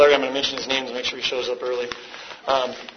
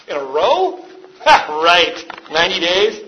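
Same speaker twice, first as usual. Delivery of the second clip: raised, incredulous scoff; mock scoffing disbelief; 4 semitones higher, 5 dB louder than usual. Watching a guy take a chip, scoffing. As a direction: scoff as if it can't be true.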